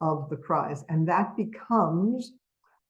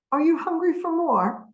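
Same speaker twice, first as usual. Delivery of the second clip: much higher rising question tune; question intonation; 12 semitones higher, 5 dB louder than usual. Shown as a demonstration. Teacher showing parents a question's rising tune. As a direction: lift it high and bright like a question to a child. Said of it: "You hear the difference in the intonation pattern?".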